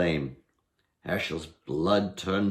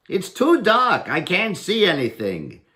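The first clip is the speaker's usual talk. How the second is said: higher, louder cocky challenge; bold taunting Herschel; 8 semitones higher, 6 dB louder than usual. Acted. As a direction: goad the goblin into lighting candles.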